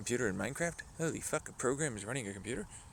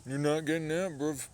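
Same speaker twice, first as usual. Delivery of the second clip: loud cocky jab; taunting; 6 semitones higher, 7 dB louder than usual. Real, not acted. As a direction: rub it in loudly. Taunting the other player.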